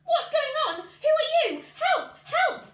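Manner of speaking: very high, loud alarmed cries; harp crying out. A narrator's reading. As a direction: scream for help in panic. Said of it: "it suddenly cried out".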